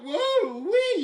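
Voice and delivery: high excited whoa; a giddy yelp of surprise. Real, not acted. Reacting to the commenter.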